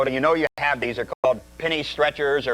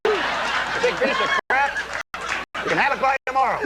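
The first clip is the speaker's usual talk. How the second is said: mock pitchman; 8 semitones higher than usual. Mocking delivery ad.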